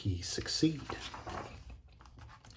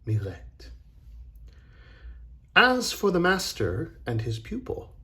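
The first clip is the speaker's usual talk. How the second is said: plain reading voice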